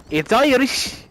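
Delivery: high, hammed-up Irish accent. Mocking the video's barman, exaggerated Irish accent. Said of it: "in the most stereotypical Irish".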